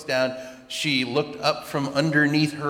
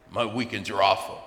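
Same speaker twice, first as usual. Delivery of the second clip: grumpy; 5 semitones lower than usual. Retold or imitated. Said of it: "something grumpy to me like".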